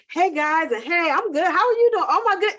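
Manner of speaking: high, put-on peppy greeting; fake-friendly, lilting up high; performative cheer. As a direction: act breezy and fine for show. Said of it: "and putting performative".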